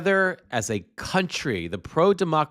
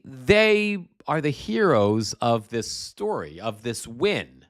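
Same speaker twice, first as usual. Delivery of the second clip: ordinary talk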